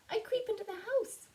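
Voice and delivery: high, squeaky-small story mouse; little mouse. A narrator's reading. Says the mouse.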